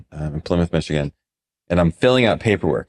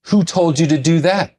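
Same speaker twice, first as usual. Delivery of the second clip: much higher, louder mock demand; imitated angry demand; 10 semitones higher, 3 dB louder than usual. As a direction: challenge him as the furious client. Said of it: "He goes".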